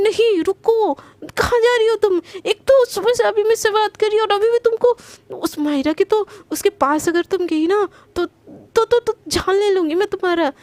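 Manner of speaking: high, loud stop-her cry; panicked angry plea. Acted. Priyanka loudly stops Garima from going to Mahira. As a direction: shout her back before she leaves.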